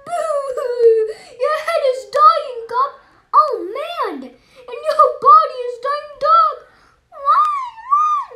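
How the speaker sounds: high, loud, laughing wail of alarm; panicked wailing doctor. Acted. Doctor panicking at the hospital, high laughing wails. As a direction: shriek the bad news half laughing, half crying.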